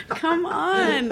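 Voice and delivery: normal talking voice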